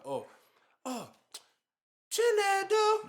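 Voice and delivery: high drawn-out parent call; calling out name. Retold or imitated. Parent calling the kid from another room, long high call.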